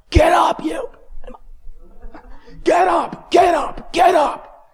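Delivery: frantic high, loud yelling; cursing himself. Retold or imitated. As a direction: scream orders at your own limp body. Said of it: "I'm cursing at myself".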